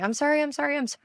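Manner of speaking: high, hurried string of sorries; high-pitched, frantic mock apology; profusely apologizing kid. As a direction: imitate a small boy apologizing over and over. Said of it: "apologizing profusely, following him, going".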